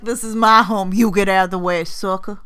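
loud playful defiance; mock bossy yell. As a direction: bark it like you own the place. Retelling her stubborn reply at home.

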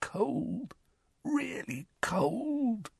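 high, soft, slow croak; weak, haunted croaking voice. A narrator's reading. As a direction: drag the words out, chilled and shaken. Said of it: "croaked Dudley".

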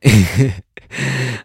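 a burst of real laughter, loud. Real, not acted. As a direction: crack up at the punchline. Laughing at his own story.

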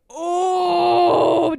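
high, loud mock gasp of alarm; a startled, loud "oh"; shocked gasp. Girls gasping in alarm.

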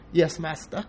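understated southern servant accent. Shown as a demonstration.

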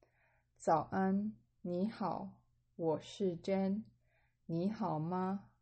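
slow, careful Mandarin line for learners; slow model reading. Shown as a demonstration. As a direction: spell it out slowly so students follow. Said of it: "you can read along with me".

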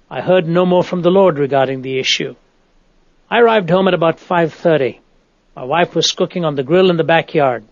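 ordinary talk, nothing marked

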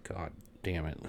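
muttered curse. Retold or imitated. Cursing to himself at the register, low and soft. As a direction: grumble it quietly to yourself. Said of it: "And I'm like".

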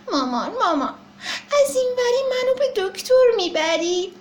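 put-on high, crying little-child plea; tearful child. Baby elephant begging his mother not to take him. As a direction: whimper to mother.

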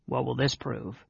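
softened private grumble; under his breath. A narrator's reading. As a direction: say it quietly to a companion. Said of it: "said under his breath".

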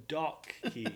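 "Docky" drawn out slowly as DOCK-ee; careful pronunciation. Real, not acted. Correcting co-host's mishearing, careful pronunciation.

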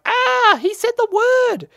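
put-on very high, loud shocked child squeal; gasping kid. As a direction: squeal like a scandalised little kid. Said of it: "any kids listening, like".

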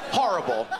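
ordinary talk, nothing marked

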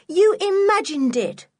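high, loud, cross retort; irritated child's denial. A narrator's reading. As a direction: throw it back crossly, voice pitched right up. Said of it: "said Anne crossly".

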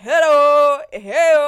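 mock yell. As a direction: call out hello like his little yells. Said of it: "his little, like, yells".

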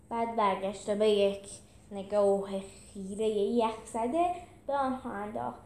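plain reading voice

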